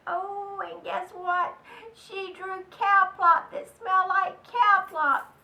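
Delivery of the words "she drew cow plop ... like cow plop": gleeful loud storyteller; much louder and higher, relish the silly gross bit. A narrator's reading. Narrator delighting the kids with cow plop, loud and gleeful.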